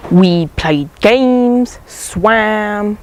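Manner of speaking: drawn-out, dreamy listing; slow, relishing reminiscence; savoring. Acted. Fondly savoring the island memories.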